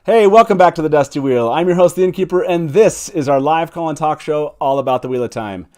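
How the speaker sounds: usual speaking voice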